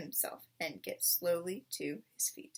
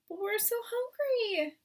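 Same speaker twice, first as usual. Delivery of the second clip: high, pleading cub voices; cubs crying out; 13 semitones higher, 4 dB louder than usual. A narrator's reading. Starving cubs crying to their parents, high. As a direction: whine it like starving children. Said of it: "they cried".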